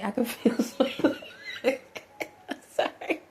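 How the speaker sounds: giggling through the words; can't hold the laugh in; laughing mid-sentence. Real, not acted. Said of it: "don't laugh at me".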